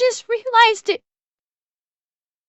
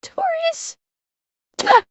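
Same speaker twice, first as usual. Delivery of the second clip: teary; 6 semitones higher than usual. Real, not acted. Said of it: "I'm crying".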